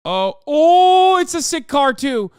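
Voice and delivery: high, loud excited yelling. Retold or imitated. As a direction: shout it like a thrilled fan. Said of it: "motherfuckers go crazy".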